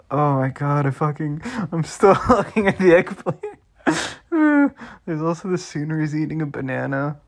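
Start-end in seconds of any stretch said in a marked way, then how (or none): 1.7-4.7 higher, breaking into real laughter; crying with laughter. Real, not acted. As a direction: lose it laughing as you finish the sentence. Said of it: "I'm fucking just crying".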